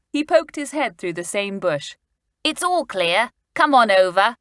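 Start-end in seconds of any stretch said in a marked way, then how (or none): 2.4-4.3 louder call across the bushes. A narrator's reading. Julian calling friends from the bush, loud. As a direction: call the others over. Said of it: "he shouted".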